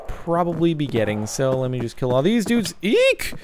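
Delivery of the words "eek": sudden high squeal of fright; startled shriek. Real, not acted. Player surprised by an attacking mob, sudden high squeal. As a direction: jump and squeal.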